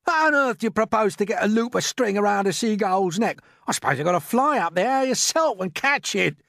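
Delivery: high, loud incredulous rant; scornful outburst. A narrator's reading. Earthworm doubting anyone could loop a seagull, scornful. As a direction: challenge him loudly, full of scorn.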